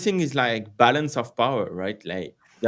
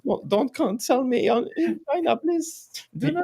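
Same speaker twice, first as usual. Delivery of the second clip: mock pleading; 14 semitones higher than usual. Jokingly begs not to be cancelled.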